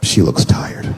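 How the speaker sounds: hushed, unvoiced aside; whispered thought. Husband whispering to himself at night, hushed. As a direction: whisper it as if not to wake her.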